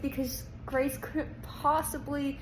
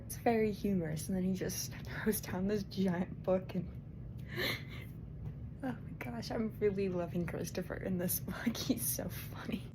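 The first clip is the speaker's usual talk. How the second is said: hushed unvoiced whisper, much softer; late-night whisper; 4 semitones lower, 7 dB quieter than usual. Real, not acted. Reader whispering book news at night, hushed whisper. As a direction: keep it secret, others asleep.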